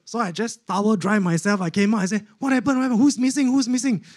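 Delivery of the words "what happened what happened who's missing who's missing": high, hurried repeated questions; mock alarm, pitched right up; bewildered questioning. Patient coming out of shower, asking in high alarm.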